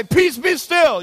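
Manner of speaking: high, slow, mock-solemn command; commanding the wave. Joking about speaking to the wave, slow mock command. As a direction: intone it like a prophet stilling seas. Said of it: "talk to the wave and say".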